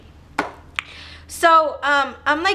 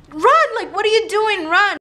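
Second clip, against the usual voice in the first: much higher, loud desperate yell; frantic shout; 7 semitones higher, 4 dB louder than usual. Retold or imitated. Screaming at her frozen friend with attackers near, frantic yell. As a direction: shriek at her to get moving.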